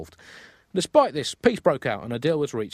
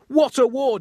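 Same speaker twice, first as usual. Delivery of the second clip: much higher and louder, hammed up; mock grand exclamation; 12 semitones higher, 7 dB louder, slower than usual. Real, not acted. Host marveling to his co-host about the pig war, hammed up. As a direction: proclaim it to the sky. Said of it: "host exclaims to co-host".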